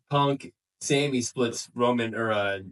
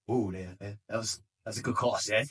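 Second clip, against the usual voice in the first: imitated crowd reaction; 2 semitones lower, 6 dB quieter than usual. Crowd reacting to Seth's comeback, hushed mock approval. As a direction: play the impressed crowd under your breath. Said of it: "the crowd was like".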